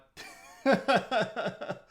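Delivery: high, loud burst of laughter; real giggling fit breaking off the sentence; uncontrolled laughing. Real, not acted. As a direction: crack up mid-sentence. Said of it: "I'm laughing at this so bad".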